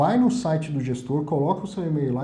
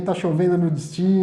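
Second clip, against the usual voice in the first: louder captain voice telling the weather; pilot announcement; 2 semitones higher, 5 dB louder than usual. Retold or imitated. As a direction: read it like a cabin announcement. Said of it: "o piloto entra lá".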